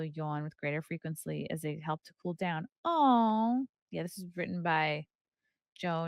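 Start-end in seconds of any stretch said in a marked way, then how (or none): 2.8-3.6 aww. Real, not acted. Reacting to babies.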